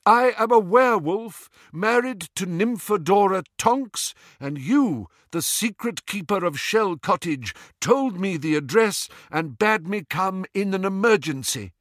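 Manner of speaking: urgent high declaration, loud at the start; calling over wind. A narrator's reading. Visitor proving his identity to those inside, calling out urgently. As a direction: proclaim it through a closed door against the gale. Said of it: "called a voice over the howling wind".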